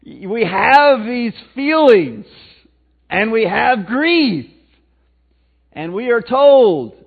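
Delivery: slow, loud preacher build-up; preaching. Acted. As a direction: drive it home from the pulpit. Preaching at full force.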